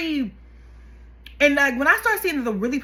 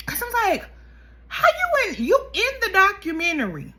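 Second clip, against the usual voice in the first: outrage; 9 semitones higher than usual. Real, not acted. Ranting about the mother crying on camera, outraged. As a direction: vent your outrage.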